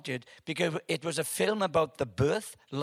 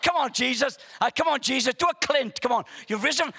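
loud, high-pitched urging; excited cheering; 10 semitones higher, 6 dB louder than usual. Retold or imitated. Moviegoer urging Jesus to act like Clint, fired up. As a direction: cheer the hero on at full voice.

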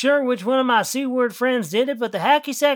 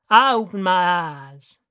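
put-on Texas cowboy accent, telling his tale; Joe Buck accent; 4 semitones lower, slower than usual. A narrator's reading. A country boy tells of his reunion in a Texas cowboy accent. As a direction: narrate the whole story in a Texas cowboy accent. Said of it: "I was trying to sound like Joe Buck".

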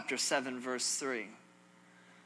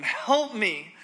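raised, louder plea for help; crying out; 5 semitones higher, 6 dB louder than usual. Acted. Desperate woman to the passing king, crying out. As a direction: call up to someone for help. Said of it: "a woman cried to him".

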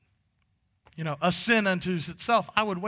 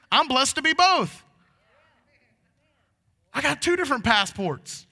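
high, loud proclaiming preacher voice; full-force preaching; 7 semitones higher, 8 dB louder than usual. Acted.

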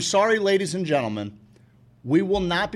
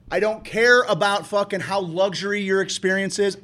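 usual speaking voice

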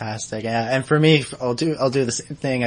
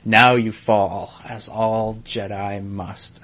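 slow, menacing, lightly put-on; mild villain menace, slow and deliberate; menacing Ventress; 3 semitones lower, much slower than usual. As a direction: draw it out like a dark threat. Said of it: "when Ventress says to Luminara".

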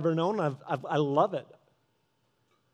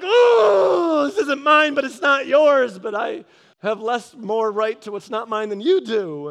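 high, loud shout that slows down; heated shouted retort; 7 semitones higher, 10 dB louder, slower than usual. Retold or imitated. Arguing with the neighbor over land neither owns, shouting. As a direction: lay out the argument in a rising rage.

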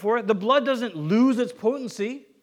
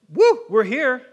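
loud whoop of arrival; mock jubilant; 7 dB louder, much slower than usual. Believers getting to go to heaven, mock jubilant. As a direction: cheer as if you barely made it.

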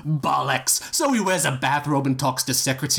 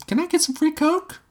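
put-on high, innocent girlish ask; ditzy high voice. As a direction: ask sweetly in a high silly voice. [name] asks bartender.

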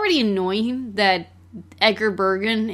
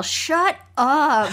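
higher, exasperated mock yell; 5 semitones higher than usual. Fed up with the film's constant commentary, mock exasperated yell. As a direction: pretend to yell at an annoying narrator. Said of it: "there's times I wanna yell".

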